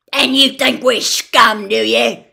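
furious loud scream. A narrator's reading. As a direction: scream it at his face. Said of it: "screamed Gaunt".

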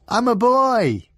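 put-on high, slow, loud boyish yell. A narrator's reading. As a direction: shout it up cheerfully. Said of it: "yelled Odd".

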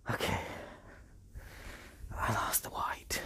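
soft unvoiced whisper of confession. Real, not acted. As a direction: whisper it like a guilty secret. Confessing to viewers.

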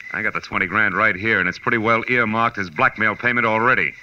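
normal talking voice

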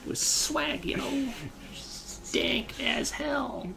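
high goofy voice, slowing at the end; silly dub voice. Pretend. Riffing on the cutscene for a friend, high goofy voice. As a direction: keep the goofy high voice going.

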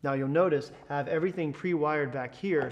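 ordinary talk, nothing marked

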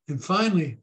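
ordinary talk, nothing marked

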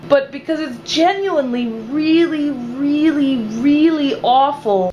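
REALLY stretched out and stressed, repeated; emphatic disgust. Real, not acted. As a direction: drag out each "really" for emphasis. Emphatic rant about hated movie.

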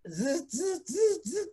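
high buzzing fax noise; fax machine buzz. Retold or imitated. Telling how the permits arrived, high mock fax buzz.

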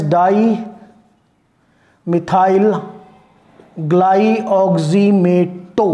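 very slow, syllable by syllable; slow dictation. Shown as a demonstration. Teacher dictating a ligand name to students, slow dictation. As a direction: spell it out in drawn-out chunks.

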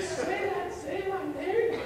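lightly mimicked schoolgirl asking again; imitating the girl. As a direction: play her wide-eyed and teasing. Girl asks him to repeat.

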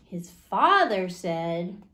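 ordinary delivery, nothing marked

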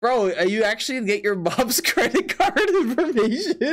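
breaking into laughter mid-question; real incredulous laugh taking over the words; laughing disbelief. Real, not acted. Streamer asks viewer about mom's credit card, laughing.

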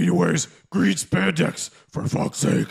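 normal talking voice

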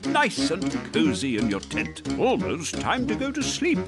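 ordinary delivery

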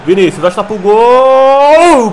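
loud, drawn-out shot call; excited commentary. Acted. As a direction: stretch the call as the shot goes. Shot on goal, live commentary.

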